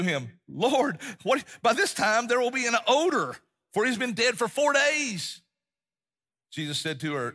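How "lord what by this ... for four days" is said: high-pitched, insistent protest; alarmed objection. Acted. Martha warning Jesus about the odor, high and protesting.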